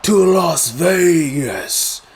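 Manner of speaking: drawn-out, high showman's announcement; The Rock. Retold or imitated. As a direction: stretch every word like a wrestling catchphrase. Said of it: "He does this whole".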